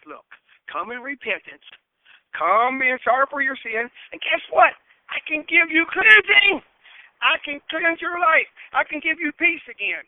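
impassioned preaching. Acted. Preacher voicing Jesus's promise to sinners, impassioned. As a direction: preach it out, building to a high, loud promise.

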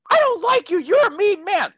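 put-on very high, childish little-girl squeal; eight-year-old girl. Game master playing a player's eight-year-old magical girl, squeaky little-girl voice. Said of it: "an eight-year-old magical girl".

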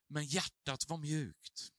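ordinary talk, nothing marked

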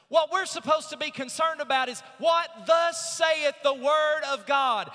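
building from strong to a loud, high shout; preaching at full force. Acted. Preacher to congregation, building to a loud shout. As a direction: pastor rising to a shout for the church about God's word.

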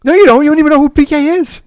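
loud high scolding; loud, high mock outrage. Real, not acted. Mock scolding about soccer.

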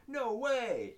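high-pitched, hammed-up disbelief; mock amazement. Host voicing kids at show and tell, mock amazed. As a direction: gasp it like imitated astonished classmates.

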